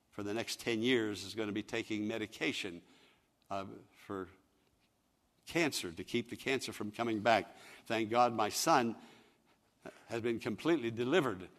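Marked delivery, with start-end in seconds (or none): none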